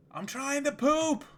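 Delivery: high, loud retold yell; yelling from the bathroom. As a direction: belt it out like she's yelling through the bathroom door. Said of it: "yells so loud that I can hear her".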